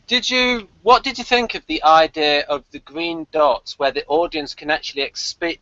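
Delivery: ordinary delivery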